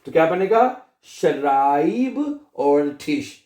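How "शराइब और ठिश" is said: शराइब and ठिश said slowly as separate parts; broken into parts. Shown as a demonstration. Teacher breaks the phrase into parts for students, slow. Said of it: "दो तीन हिस्सों में तोड़ के पढ़ लिया करें".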